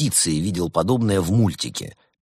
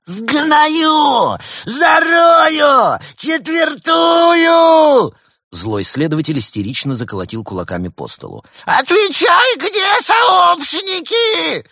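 hysterical shriek, far higher and louder, drawn out; 8 dB louder, much slower than usual. A narrator's reading. Hysterical bad cop shrieks threats at the penguin. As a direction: shriek like you have lost it. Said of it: "перешёл на визг".

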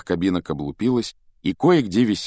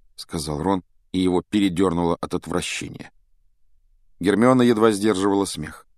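usual speaking voice

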